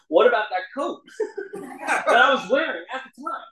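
can barely get the words out for laughing; laughing while retelling the sponsor's line. Retold or imitated. Sponsor quote told laughing.

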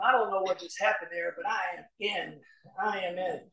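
softly, like a private thought to himself; quiet inner thought. Retold or imitated. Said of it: "I'm watching his face going".

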